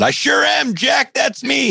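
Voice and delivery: high, eager yelp; excited. Retold or imitated. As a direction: shout it out like a thrilled fanboy. Eager reply to famous speaker.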